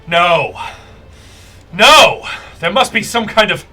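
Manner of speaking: high, loud cries of denial; Akene in disbelief. Acted. Merchant prince watches their duelist lose, crying out in disbelief. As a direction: protest in outrage, pausing between bursts. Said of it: "you guys hear Akene go".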